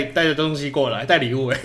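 usual speaking voice

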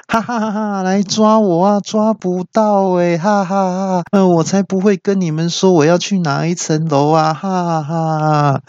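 high, mock villain laughter and jeering; gleeful taunting. Friend escaping into elevator mocks the guard, gloating. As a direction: drag out the sing-song gloating.

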